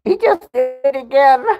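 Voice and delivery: high, squeaky words squeezed between laughs; really cracking up while talking; helpless laughter. Real, not acted. Host telling Christine he did it again, laughing. Still cracking up after apology.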